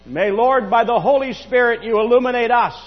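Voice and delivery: loud, high preaching prayer; fervent prayer. Acted. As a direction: pray out with full pulpit force.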